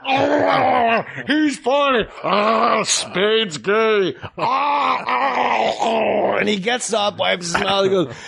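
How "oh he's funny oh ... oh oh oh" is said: higher, put-on voice with cries and yelps; over-the-top goofy moaning. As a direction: ham it up with wild oh's. Farley's absurd crotch bit.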